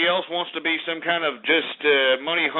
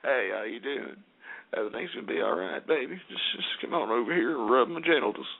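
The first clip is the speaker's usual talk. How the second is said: much lower and softer, gentle Southern charm; low, soft seductive Southern croon; Bill Clinton; 7 semitones lower, 3 dB quieter than usual. Retold or imitated. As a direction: sweet-talk like a smooth Southern charmer. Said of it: "gentle Southern charm".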